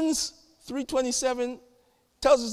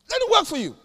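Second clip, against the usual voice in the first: "Let it work for you" said much higher and louder, with "work" punched; preaching it louder; 12 semitones higher, 5 dB louder, faster than usual. Shown as a demonstration. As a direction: throw the repeat out to the church, high and loud.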